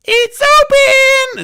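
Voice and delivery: very high, loud, drawn-out cry; mock Millie shrieking the door is open; squealing Millie. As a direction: stretch it out in a piercing squeal. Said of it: "Millie would be like".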